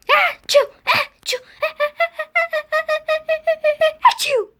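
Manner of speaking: loud high-pitched sneeze; big comic sneeze; sneezing. Acted. Character sneezing repeatedly.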